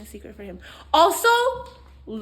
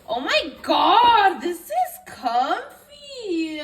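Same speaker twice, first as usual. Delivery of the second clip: high, slow, drawn-out wail of delight; delighted squeal; 6 semitones higher, 9 dB louder, much slower than usual. Real, not acted. Trying the comfy baby gift, delighted squeal. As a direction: swoon loudly over it.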